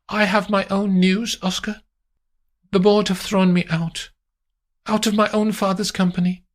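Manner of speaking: high, bitter lady; high voice, indignant; Amandine. Acted. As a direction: spit out the humiliation. Amandine telling her news.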